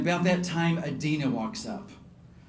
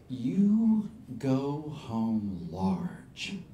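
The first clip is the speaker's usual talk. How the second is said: very slow, lower, heartfelt charge; solemn encouragement; 4 semitones lower, slower than usual. Retold or imitated. Singer sending refugee home, solemn and slow. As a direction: give each word weight, earnestly.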